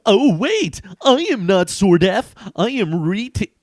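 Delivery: high, loud hero protest. Acted. Hero correcting the villains, high and loud. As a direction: insist like a cartoon hero. Back to my regular character.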